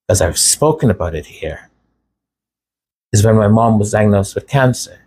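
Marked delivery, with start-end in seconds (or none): none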